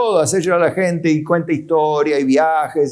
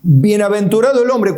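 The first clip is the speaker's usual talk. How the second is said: emphatic quote; 6 semitones higher, 3 dB louder than usual. Acted. Quoting scripture emphatically.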